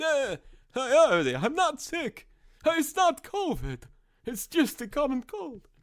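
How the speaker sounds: high, put-on strained voice; hammed-up croak insisting he's fine; mock sick voice. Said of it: "Did I say I'm sick?".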